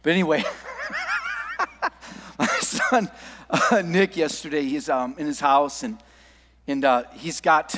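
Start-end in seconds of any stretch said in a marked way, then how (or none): 0.5-1.9 high, wheezy burst of laughter; helpless laugh. Real, not acted. Father starting a funny story about his son, laughing. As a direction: break up laughing before the story.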